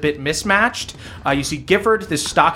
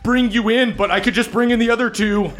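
nervous bargaining bully; 7 semitones higher than usual. Acted. Gifford bargains with Saul.

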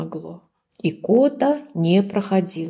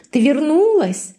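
high, loud delighted question; the wolf, delighted, greets the returned sheep; joyful exclaim; 8 semitones higher, 4 dB louder than usual. A narrator's reading. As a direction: burst out with happy relief. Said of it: "радостно воскликнул старый волк".

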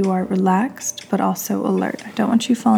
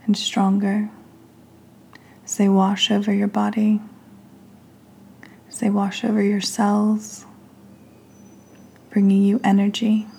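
guided meditation. Real, not acted. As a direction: lead them gently through each breath, leaving space between phrases.

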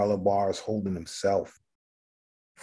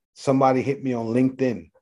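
ordinary talk

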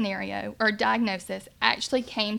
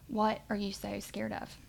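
much softer, calm and firm; a hushed, steady question from God; quiet firm voice; 8 dB quieter than usual. Retold or imitated. God asking the worried mother in the bath, quiet and firm. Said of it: "in a quiet, firm voice".

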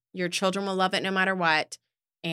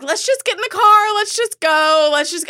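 higher, louder rushed urging; frantic hurrying; 10 semitones higher, 7 dB louder than usual. Retold or imitated. Mom urging crying kids into the car, frantic. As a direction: herd everyone out fast. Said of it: "I'm like".

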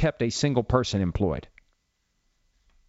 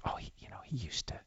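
hushed, breathless whisper; gossipy whisper; 17 dB quieter than usual. Pretend. Nursing home residents gossiping about the old podcaster, whispered.

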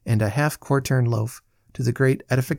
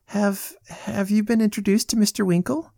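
put-on high, stammering young lady; blushing, flustered; 9 semitones higher than usual. A narrator's reading. Arabella introduces Mister Winkle to her brother, flustered. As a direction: stumble shyly into the question, pitch raised.

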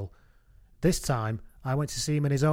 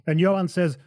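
ordinary talk

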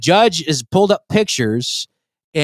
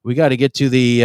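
ordinary delivery

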